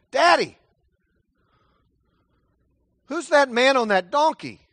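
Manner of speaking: put-on high, curious child's voice; a small child tugging at dad, asking; child asking father. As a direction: ask like a wide-eyed kid. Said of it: "you ask your father".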